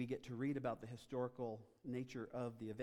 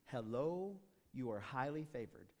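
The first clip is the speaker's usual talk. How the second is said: mock angel greeting; 3 dB louder, slower than usual. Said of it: "a messenger from God and says".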